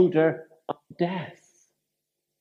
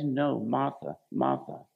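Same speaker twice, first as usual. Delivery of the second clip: softer and lower, tenderly correcting; gentle reply; 7 semitones lower, 8 dB quieter than usual. Retold or imitated. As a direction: ease her grief, quietly and lower. Jesus answers Martha gently.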